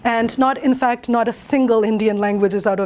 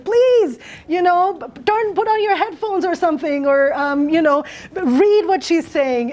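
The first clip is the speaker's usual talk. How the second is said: imitated yelling; 5 semitones higher than usual. Daughter to her hard-of-hearing mom about the device, mock yelling. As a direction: plead as if calling to someone hard of hearing. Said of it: "'cause I'm yelling at my mom going".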